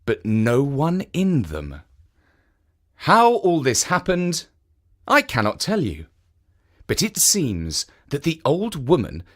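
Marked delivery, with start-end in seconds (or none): none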